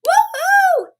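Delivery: very high cheer; high excited whoop; cheering woohoo. Real, not acted. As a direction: whoop it up for the winner. Celebrating Lisa's win.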